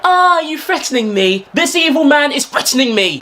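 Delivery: high, whiny outrage; hammed-up victim. Fan playing victim.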